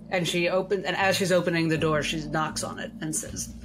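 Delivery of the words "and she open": normal talking voice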